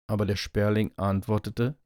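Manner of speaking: ordinary delivery, nothing marked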